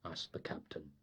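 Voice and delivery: ordinary delivery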